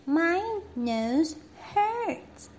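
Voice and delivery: high, whimpering little princess voice; crying little princess. Acted. Princess crying to the doctor about her nose. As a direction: complain like a hurt little girl. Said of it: "cried the little princess".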